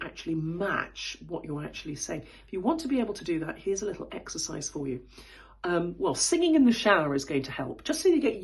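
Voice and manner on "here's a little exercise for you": whisper. Real, not acted. Aside to viewers.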